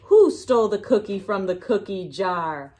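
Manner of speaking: plain reading voice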